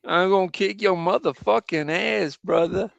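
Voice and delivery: a Brit's hammed-up American tough-guy accent; West Coast American accent. Shown as a demonstration. Brit mimicking a bad American accent, hammed-up West Coast threat. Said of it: "West Coast".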